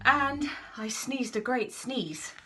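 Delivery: wolf savouring the sneeze; big sneeze told slowly. Acted. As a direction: stretch out the great sneeze.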